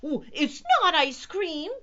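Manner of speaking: high, loud squeak of protest; little squeaky cat voice. A narrator's reading. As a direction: squeak it out indignantly. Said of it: "he squeaked".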